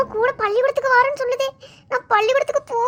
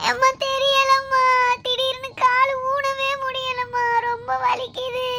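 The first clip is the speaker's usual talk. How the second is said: fake whimper; slower than usual. Girl faking a sore leg to skip school, pitiful whimpering. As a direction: play the pitiful patient.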